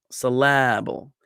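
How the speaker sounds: slow "syllable" as suh-LAAB-ul; stress on LA. Shown as a demonstration. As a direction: stretch the middle "la" of "syllable", weak ends. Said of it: "si is de-emphasized and the bo is de-emphasized".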